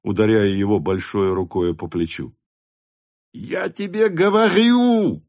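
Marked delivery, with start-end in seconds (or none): 3.3-5.2 very high, drawn-out cry; excited shout. A narrator's reading. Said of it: "закричал Денисов". (высокий протяжный крик)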